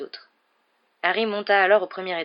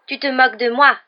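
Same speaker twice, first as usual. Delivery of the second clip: loud, higher, wounded protest; tearful accusation; 6 semitones higher, 10 dB louder than usual. A narrator's reading. Ghost girl who feels mocked, tearful and loud. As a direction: accuse them, hurt and loud. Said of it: "avec des larmes".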